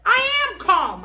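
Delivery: high, very loud slow shout; hollering. A narrator's reading. Junie hollering at the teacher, high and loud. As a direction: holler it back. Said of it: "I hollered back".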